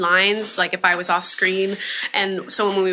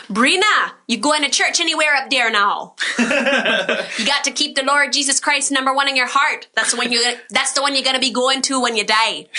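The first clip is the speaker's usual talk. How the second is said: Roatan island English, higher and lively, Michigan meets Jamaican; Roatan accent; 5 semitones higher than usual. Retold or imitated. Stepmother from Roatan nagging about church, in Roatan accent. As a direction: imitate the Roatan stepmother's family accent with a laugh. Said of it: "there's one phrase ... I hear".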